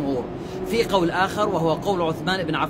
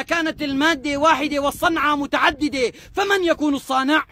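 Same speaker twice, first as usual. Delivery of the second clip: fervent preaching; 9 semitones higher than usual. Acted. Preacher challenging an atheist, rising fervour. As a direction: preach it at full force to convince.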